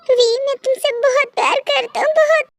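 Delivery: high, tearful whisper of love; tearful confession. Acted. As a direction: confess love while crying alone. Tearful confession after walking away.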